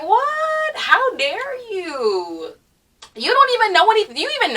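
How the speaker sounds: rear back in disbelief; outraged disbelief. Real, not acted. Answering the teacher's jazz advice, outraged and high.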